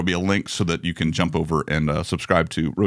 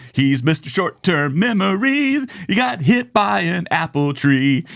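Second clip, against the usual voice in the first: TV sketch intro; 9 semitones higher, slower than usual. Retold or imitated.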